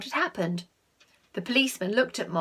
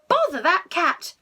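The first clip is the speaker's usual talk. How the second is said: higher and louder grumble; exasperated scolding; 7 semitones higher, 6 dB louder than usual. Acted. The parents woken by the shouting, fed-up scolding. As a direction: spit it out, fed up.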